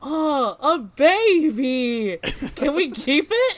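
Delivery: cooing delight. Acted. Player as Milo begging to keep the hamster, delighted coo. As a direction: beg sweetly to keep the pet. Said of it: "Milo's like".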